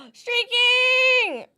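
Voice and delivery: loud high exclamation; excited shout. Real, not acted.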